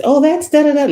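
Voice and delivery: put-on high, bright child's reply; daughter's voice. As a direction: toss it off like a carefree little girl. Mother voicing daughter's answer.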